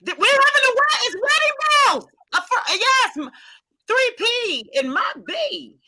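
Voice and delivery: loud, high-pitched celebration; thrilled, soaring and loud; excited announcement. Real, not acted. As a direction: belt out the happy wedding news. Announcing wedding bells with excitement.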